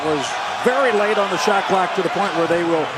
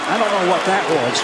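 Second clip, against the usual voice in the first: normal talking voice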